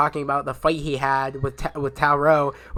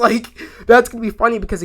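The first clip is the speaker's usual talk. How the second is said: said through a real laugh, fast; amused, laughing it out; 6 semitones higher, 6 dB louder, faster than usual. Real, not acted. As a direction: chuckle through the words, a bit higher and louder. Laughing while picturing reaction.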